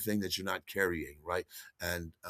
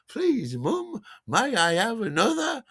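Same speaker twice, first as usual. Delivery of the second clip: put-on high, childlike begging for more; squeaky polite plea; 14 semitones higher, 4 dB louder, slower than usual. Tripping passenger pleads with the stewardess for more champagne, high and childlike. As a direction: ask like a meek little child.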